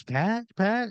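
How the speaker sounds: high calling of a name. Acted. Wife calling husband in garage.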